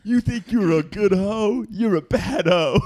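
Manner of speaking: slow, high drill sergeant taunt. Pretend. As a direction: sneer down at a weak recruit. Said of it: "let me try being drill sergeant".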